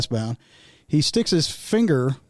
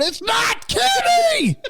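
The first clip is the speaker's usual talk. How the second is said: very high, drawn-out shout; stretched, high mimicked yell; yelling correction; much slower than usual. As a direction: imitate a grandson hollering up at granny. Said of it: "He's going".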